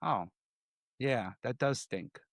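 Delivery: soft caring murmur; gentle comfort. Retold or imitated. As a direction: hush and comfort the kid. Comforting a crying kid.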